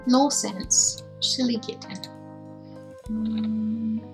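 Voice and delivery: ordinary talk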